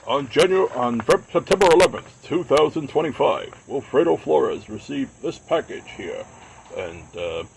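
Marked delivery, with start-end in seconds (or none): 0.1-6.2 loud, higher, dramatic true-crime host; hammed-up mystery show narrator; Robert Stack narrator. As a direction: announce it like an unsolved-mysteries host. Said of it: "my very poor Robert Stack impression".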